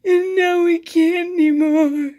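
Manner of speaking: high, loud, slow mock sobbing; mocking fake crier. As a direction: ham up a tearless crying kid. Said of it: "the worst crier".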